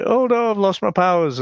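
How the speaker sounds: high-pitched mocking whine of dismay; hammed-up helpless lament, pitched high. Host imitating Ben as he loses his powers, mock dismay. Acted panicky quote from film.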